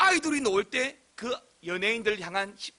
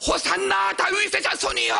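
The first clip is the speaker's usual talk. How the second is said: high, drawn-out acclaiming cry; crowd shouting; 12 semitones higher than usual. Retold or imitated. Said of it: "사람들이 고함을 질렀어요". (군중처럼 높게 외치는 고함)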